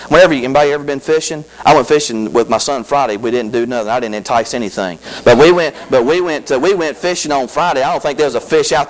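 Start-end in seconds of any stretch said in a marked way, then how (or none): none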